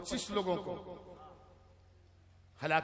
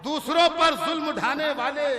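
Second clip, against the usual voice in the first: high, loud declamation; forceful verse recital; 6 semitones higher, 9 dB louder than usual. Acted. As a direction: project the couplet over the crowd. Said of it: "किसी शेर पर जोर लगाऊंगा". (ज़ोर लगाकर शेर पढ़ना)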